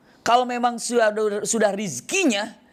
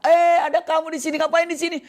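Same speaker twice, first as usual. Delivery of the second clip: much higher, startled voice; surprised traveler; 10 semitones higher, 3 dB louder than usual. Acted. Traveler hears a sound behind the mosque carpet, startled high voice. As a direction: perk up at a strange noise, pitched high. Traveler finds hidden man.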